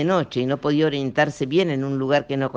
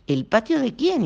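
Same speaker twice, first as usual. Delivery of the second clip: higher, pressing question; insisting; 10 semitones higher than usual. A narrator's reading. Lucas insisting, puzzled, on whose patio. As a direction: push the question harder. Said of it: "insistió Lucas".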